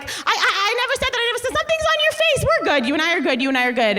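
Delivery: a high-pitched Bethany sidestepping; imitating Bethany. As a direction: squeak it out in a hurried mock denial. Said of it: "With, like".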